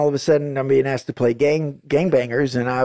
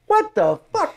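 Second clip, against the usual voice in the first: mock disbelief. Director reacting to the choloed-out actor, high mock disbelief. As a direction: stretch it out in a squeaky, stunned pitch.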